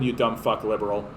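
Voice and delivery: ordinary talk, nothing marked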